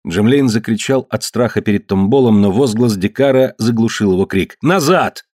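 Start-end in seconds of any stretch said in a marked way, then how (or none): 4.6-5.2 high, sharp order; shouted command. A narrator's reading. Dikar stops Tombol in his tracks, commanding cry. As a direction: order him back with a raised voice. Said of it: "крикнул Дикар".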